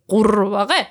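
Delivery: Qurbaghe with a rolled, croaking r and throaty gh; frog-like croak. Shown as a demonstration. Teacher likening the word to frogs, croaky Qurbaghe. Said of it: "like the sound that frogs make".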